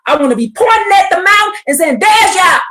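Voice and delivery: shouting while pointing at the mountain; excited shout. Pretend. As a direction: point and cry out 'There's Yah'. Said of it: "screaming and shouting to the top of my lungs".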